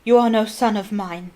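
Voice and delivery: loud cold bellow; a father disowning his son; booming rejection. Acted. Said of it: "bellowed Mister Crouch".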